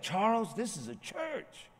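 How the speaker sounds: mock high stern woman's scold; high-pitched church-lady rebuke, hammed up; Karen scolding. Sergeant scolding Charles about cursing in church, mock high scold. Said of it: "and Karen, the sergeant, said".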